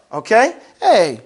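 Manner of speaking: usual speaking voice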